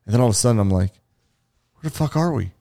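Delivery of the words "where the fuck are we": high-pitched bewildered question; acting out confusion, pitched up. Dreamer asks his friend where they are, bewildered.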